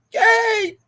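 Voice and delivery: high-pitched holler for someone lost; yelling out. Acted. Clowning to friends, yelling for a missing Gabe. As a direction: call out at full shout, voice way up. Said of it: "when he yelled like that".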